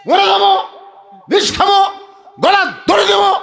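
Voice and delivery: high, loud acted labour screams; cry of agony. Preacher acting a woman in labour, agonised yelling. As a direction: shout each threat as a separate burst. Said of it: "তখন কষ্টের চোটে বলে".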